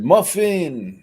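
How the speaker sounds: high, loud exclamation; exclaimed. Retold or imitated. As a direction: read it out with an exclamation point. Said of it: "It has an exclamation point".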